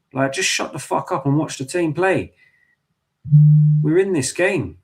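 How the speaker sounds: frustrated fan snapping at moaning supporters; angry rant. Real, not acted. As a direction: tell them off, fed up. Ranting at complaining fans.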